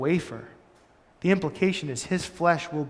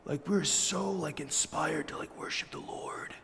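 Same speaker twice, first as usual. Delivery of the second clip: hippie surfer guys; 2 semitones lower, 10 dB quieter than usual. Retold or imitated. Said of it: "total hippies, surfer guys".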